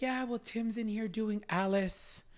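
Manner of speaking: high, slow British woman; British accent. Retold or imitated. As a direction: imitate a British lady, unhurried. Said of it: "in her British accent".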